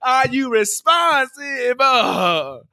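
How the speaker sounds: high, slow, shouted question; mock shout. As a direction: yell it at the audience.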